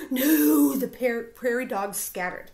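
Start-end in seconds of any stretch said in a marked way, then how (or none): 0.1-0.8 loud, high, drawn-out cry; alarmed cry. Acted. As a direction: cry out in horror at a fall. Crowd watches Big Bark fall.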